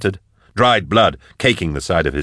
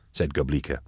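ordinary delivery, nothing marked

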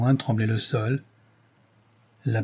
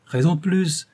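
higher-pitched, mildly insistent; 6 semitones higher than usual. A narrator's reading. Saint-Chet presses Rose to return, insistent. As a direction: press the point, voice lifted. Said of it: "insista Saint-Chet".

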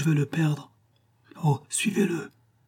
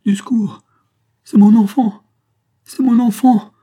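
loud, high anguished mother's scream; heart-rending cry; 10 semitones higher, 11 dB louder than usual. Acted. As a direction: scream desperately for help for your child. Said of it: "avec un cri déchirant".

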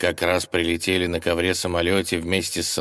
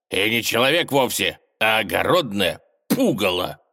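denouncing tsar; 4 semitones higher, 3 dB louder than usual. Acted. Tsar exposes the fraud loudly.

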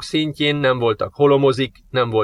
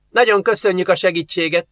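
mock-polite, lightly grumbled thanks; sarcastic grumble; 6 semitones higher than usual. Girl grumbles sarcastic thanks at the unhelpful neighbour. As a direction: thank him through a touch of sarcasm. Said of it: "morogta a lány".

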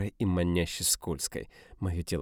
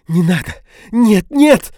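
much higher, louder shrieks; panicked screams; 18 dB louder, slower than usual. A narrator's reading. Dina struggling.

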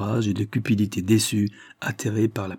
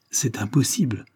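plain reading voice

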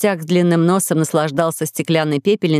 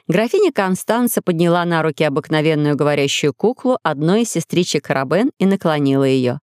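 ordinary delivery

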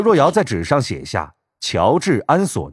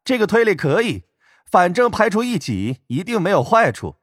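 high, mocking young man with a laugh; teasing laugh; 9 semitones higher than usual. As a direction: laugh and tease sweetly. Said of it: "白若瑶笑道".

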